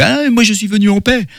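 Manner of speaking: playful, high put-on proclamation; mock peace greeting. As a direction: pitch it way up, stretching the opening "Ah". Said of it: "comme, euh, Monsieur Spock".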